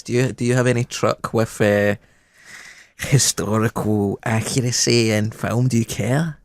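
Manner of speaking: usual speaking voice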